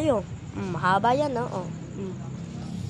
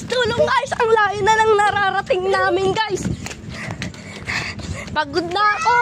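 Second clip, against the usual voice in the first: out of breath calling for help; gasping cries on the run; while running; 7 semitones higher than usual. Real, not acted. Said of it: "Ay, nakakapagod".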